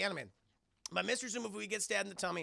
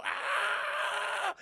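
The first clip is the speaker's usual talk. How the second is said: long, high loud scream; mock falling scream; 13 semitones higher, 5 dB louder than usual. Ricky Tan sent out the window, mock falling scream. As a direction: imitate someone flying out a window.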